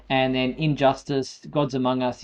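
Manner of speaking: usual speaking voice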